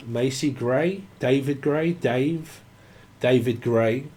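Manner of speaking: normal talking voice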